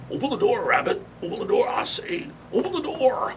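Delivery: high, hammed-up cartoon cowboy holler; Yosemite Sam yelling. Yosemite Sam pounding on the boxcar door at Bugs, hollering high. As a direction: holler it at the locked door like a hot-tempered little cowboy.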